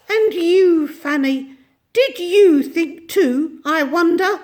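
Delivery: put-on high, bright old little woman voice; tiny ancient shopkeeper. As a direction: chatter away as a tiny ancient lady. Mrs Corey interrogates Fanny.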